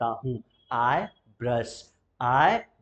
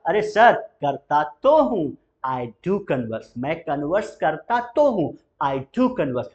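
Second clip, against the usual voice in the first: insist, pressing तो and do; emphatic reply; 5 dB louder than usual. Shown as a demonstration. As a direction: stress on तो and do, "करता तो हूँ" and "I do converse". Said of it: "जब आप emphasise". ("तो" और "do" पर ज़ोर)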